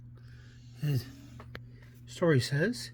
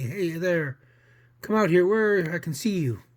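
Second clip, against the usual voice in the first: loud, raised challenge; calling out; 3 semitones higher, 5 dB louder than usual. Acted. Costa challenging whoever closed the door, calling out. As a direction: call into the dark, higher and louder. Costa calls to unseen person.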